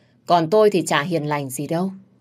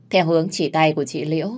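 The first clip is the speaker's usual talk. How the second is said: ordinary delivery, nothing marked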